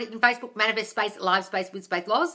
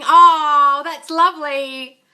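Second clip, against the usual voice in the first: high, slow tender "lovely"; touched delight; 6 semitones higher, 11 dB louder, much slower than usual. Real, not acted. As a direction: melt at the sweet story. Touched by the child's remark.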